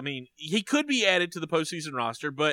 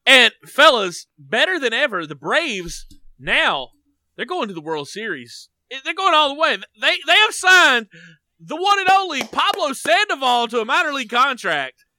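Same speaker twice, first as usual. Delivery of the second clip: loud, high, hyped-up announcement; building to a high, loud hype; excited; 8 semitones higher, 7 dB louder than usual. Real, not acted. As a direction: proclaim big team news with rising excitement. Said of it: "I'm excited".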